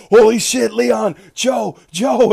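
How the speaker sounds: high, loud mock panic of the group; retold alarmed shouting. Fellow players calling the absent player back, mock alarmed shouting. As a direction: imitate friends urgently yelling for someone. Everyone urgently calling for him.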